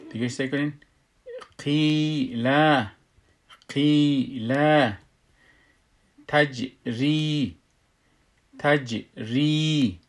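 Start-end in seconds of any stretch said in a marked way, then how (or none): 1.6-4.5 قیل with a long-held ī; madd pronunciation. Shown as a demonstration. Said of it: "باید درست تلفظش کنیم". 6.3-10.0 تجری slowly, bounced j and long ī; madd and qalqalah. Shown as a demonstration. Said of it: "اینجا تجری نمیگیم".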